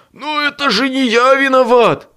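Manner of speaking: high, loud offended protest; wounded, defensive objection. A narrator's reading. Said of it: "обиженно возразил Боб".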